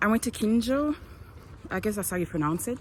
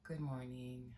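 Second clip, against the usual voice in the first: quiet; 4 semitones lower, 18 dB quieter, slower than usual. Real, not acted. Just woke up, morning greeting.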